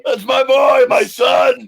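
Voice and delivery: high, loud hammed-up scream. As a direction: wail it out as an overwrought movie father. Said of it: "Cecil starts screaming in his face".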